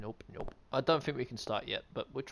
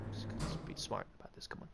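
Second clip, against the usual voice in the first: whispering; 7 semitones lower, 13 dB quieter, faster than usual. Real, not acted. Whispered sneaking.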